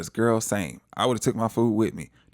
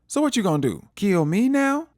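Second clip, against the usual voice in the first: mock challenge rising high and louder; acted taunt; 10 semitones higher, 4 dB louder than usual. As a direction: goad him, climbing to a high, louder finish.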